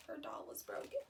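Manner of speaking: soft, sad whisper. A narrator's reading. Narrator whispering to the children about the broken doll. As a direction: whisper the sad part gently.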